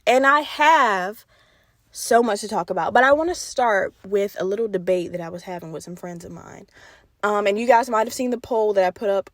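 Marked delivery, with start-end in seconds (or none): none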